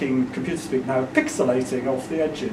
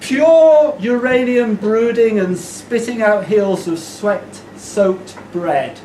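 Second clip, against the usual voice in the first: slow, deliberate poem delivery; measured, stretched-out performance reading; poetry recital; 5 semitones higher, 5 dB louder, much slower than usual. Acted. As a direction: declaim each word with weight.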